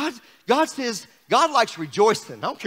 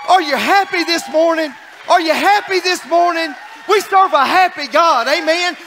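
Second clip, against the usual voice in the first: loud, raised revival call; rousing preaching; 5 semitones higher, 5 dB louder than usual. Real, not acted. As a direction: fire up the congregation. Preacher rallying the congregation.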